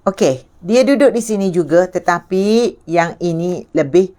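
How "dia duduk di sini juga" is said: ordinary delivery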